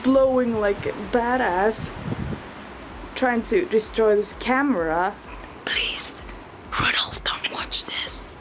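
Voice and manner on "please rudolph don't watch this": hushed unvoiced aside; whisper. Real, not acted. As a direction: whisper it secretly.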